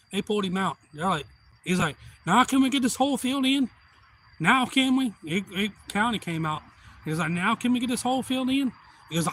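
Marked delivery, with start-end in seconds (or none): 2.3-5.1 high, loud demanding yell; indignant shout. Retold or imitated. Stuck truck owner yelling at the county, loud and high. 7.3-8.7 mock demand. Repeats the owner's demand.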